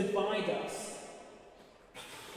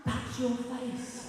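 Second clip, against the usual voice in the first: mock realization; 5 semitones higher, 3 dB louder, much slower than usual. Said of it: "And I was like".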